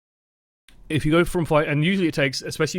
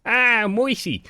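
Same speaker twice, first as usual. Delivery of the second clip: high, hammed-up New York gangster accent; 9 semitones higher, much slower than usual. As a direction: greet him like a New York mobster, pitched way up. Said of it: "like, gangster movie".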